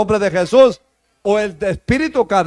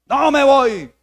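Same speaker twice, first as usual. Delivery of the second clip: higher, emphatic demon refusal; defiant demon; 5 semitones higher, 3 dB louder, slower than usual. Retold or imitated. Demon refuses the leader's command, defiant. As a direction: dig in as the retold demon. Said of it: "Y el demonio dijo".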